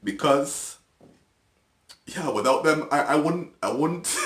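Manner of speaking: choked up, pitch and volume rising; real tears close, struggling to get the words out; almost in tears. Real, not acted. Speaker thanking people who chose to help, almost in tears. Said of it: "I'm almost in tears right now".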